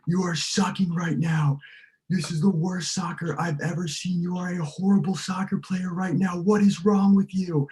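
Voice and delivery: high, loud furious coach yelling. Retold or imitated. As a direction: tear into the player like an enraged coach. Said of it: "he's yelling so hard".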